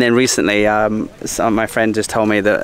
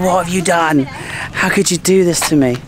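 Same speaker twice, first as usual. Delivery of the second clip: higher, hammed-up hurt outrage; mock angry wife; 7 semitones higher than usual. Wife confronting her cheating husband, mock outraged. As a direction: play the betrayed wife, a touch melodramatic. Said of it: "She's pretty pissed off".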